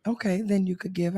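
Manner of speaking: ordinary delivery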